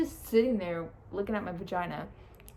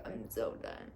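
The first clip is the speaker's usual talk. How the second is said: drained; 12 semitones lower, 7 dB quieter than usual. Retold or imitated. Exhausted after birth.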